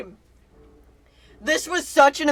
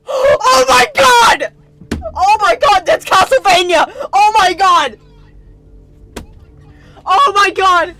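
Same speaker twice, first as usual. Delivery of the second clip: real shrieking joy at full volume; excited screaming; 8 semitones higher, 8 dB louder, slower than usual. Real, not acted. Streamer screaming at the Castlevania reveal, ecstatic. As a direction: scream with hype, voice cracking high. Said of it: "I get so excited. I tend to fucking yell and scream".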